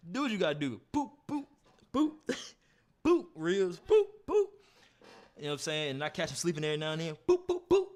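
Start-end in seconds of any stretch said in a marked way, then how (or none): 0.9-4.5 high loud boop punch noises; punch sound effects. Retold or imitated. Acting out body shots in sparring, high punchy boops. 7.3-7.9 high loud boop punch noises; punch sound effects. Retold or imitated. Acting out body shots in sparring, high punchy boops.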